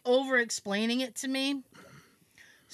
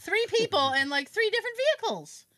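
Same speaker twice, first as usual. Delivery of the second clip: incredulous, higher; 8 semitones higher than usual. Retold or imitated. Incredulous at three trucks.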